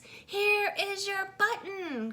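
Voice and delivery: high, slow, excited call; Frog crying out. A narrator's reading. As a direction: Frog bursts out with the find, stretched out high. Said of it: "cried Frog".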